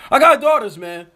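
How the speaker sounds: high, loud mocking parrot of his line; mocking the singer. Host mocking the singer's line, high and loud. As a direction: throw his words back at him, mocking. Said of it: "mocking the artist's line".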